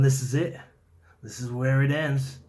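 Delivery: loud, hard-pressed threat to a captive; forceful threat. Retold or imitated. Retold threat at gunpoint.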